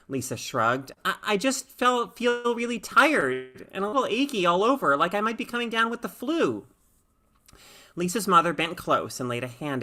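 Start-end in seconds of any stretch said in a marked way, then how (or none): 1.0-6.7 higher, lightly voiced weary girl; a hint of a sick kid listing aches; tired girl. Lisa describes feeling sick.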